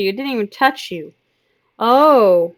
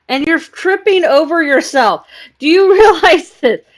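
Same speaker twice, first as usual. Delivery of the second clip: laughing scold; 7 semitones higher, 8 dB louder than usual. Real, not acted. Scolding cat while laughing.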